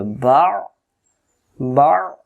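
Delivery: বা ending in a tight pharyngeal squeeze; Ain caught in throat. Shown as a demonstration. Teacher drills a hard syllable, throat-clamped end on বা. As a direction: choke off the end of each বা in the throat. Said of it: "গলায় লাগবে".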